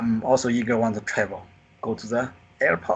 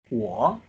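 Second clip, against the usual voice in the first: "wo" starting lower and rising; second tone; 4 semitones lower than usual. Shown as a demonstration. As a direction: lift "wo" upward like a question. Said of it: "the second tone from here to here".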